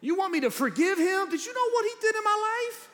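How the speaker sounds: high-pitched, incredulous outburst; indignant protest. Acted. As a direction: object in mock outrage, voice shooting up. Acted indignant objection to God.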